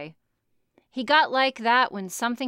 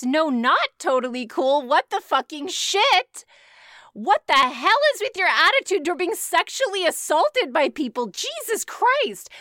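outraged; 7 semitones higher, 6 dB louder than usual. Real, not acted. Reader outraged at the story.

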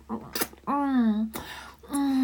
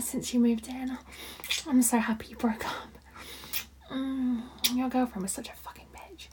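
soft ASMR whisper; 5 dB quieter than usual. Acted. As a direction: breathe the words close to the mic. Sustained whispered roleplay.

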